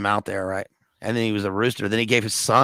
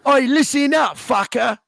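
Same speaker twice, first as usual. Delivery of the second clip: mock English accent; 11 semitones higher than usual. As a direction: growl threats in an overdone Cockney accent. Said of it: "just do your English accent".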